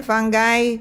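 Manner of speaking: fungi with a hard g and final "eye"; fungi as fun-guy. Shown as a demonstration. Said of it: "got various pronunciations".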